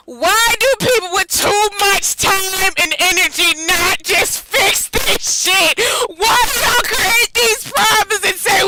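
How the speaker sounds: high, outraged rant with drawn-out words; yelling. Real, not acted. Streamer ranting at people who run away from problems, outraged yell. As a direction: yell it in exasperated disbelief. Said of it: "I'm just yelling".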